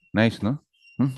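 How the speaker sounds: ordinary talk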